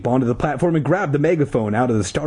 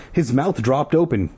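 usual speaking voice

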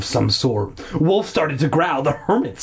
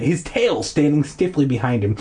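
ordinary delivery, nothing marked

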